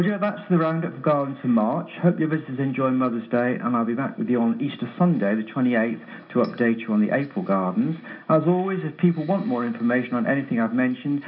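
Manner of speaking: usual speaking voice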